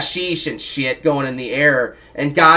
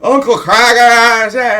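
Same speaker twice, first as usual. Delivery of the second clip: high, loud and drawn out, slow; slow, shouted boast to disbelieving parents; gleeful name-drop; 8 semitones higher, 8 dB louder, much slower than usual. Retold or imitated. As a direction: stretch the name out like a trophy. Kid proves story to parents.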